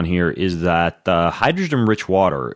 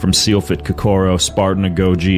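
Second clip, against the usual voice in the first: punchy, louder announcer read over music; podcast intro announcer; 4 dB louder than usual. Acted. Host introducing himself to new listeners, announcer style. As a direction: sell the show like a trailer voiceover.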